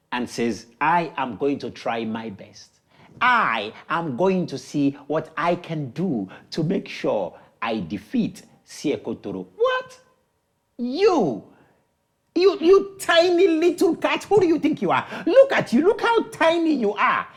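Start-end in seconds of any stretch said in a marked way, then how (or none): 0.8-9.5 low, soft and measured; a small challenger quietly stating intent; modest little Cat. Acted. Small Cat volunteers to fight. 9.5-17.3 high-pitched, incredulous mockery; scornful disbelief. Villagers scoff at tiny Cat, scornful and incredulous.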